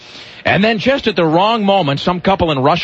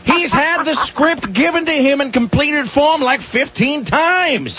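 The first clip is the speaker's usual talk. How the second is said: exasperated rant; 10 semitones higher, slower than usual. Real, not acted. Ranting about the Indiana Jones film.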